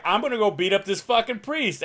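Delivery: Sweeney. Retold or imitated. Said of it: "He was like".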